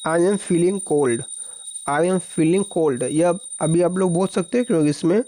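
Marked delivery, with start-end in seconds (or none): 0.1-3.0 slow dictation. Shown as a demonstration. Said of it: "English में कैसे बोलेंगे?".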